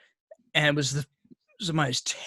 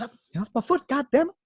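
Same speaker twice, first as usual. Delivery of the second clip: high, strained complaint; mock urgent exasperation; 7 semitones higher, faster than usual. Retold hunter with his foot stepped on, urgently exasperated. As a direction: act out a pestered man giving orders.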